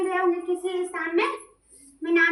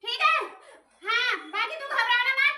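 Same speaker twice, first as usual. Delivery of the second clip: possessed deity voice; 7 semitones higher than usual. Acted. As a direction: speak as the goddess inside the medium, pitched up and forceful. Possessed medium speaking as deity.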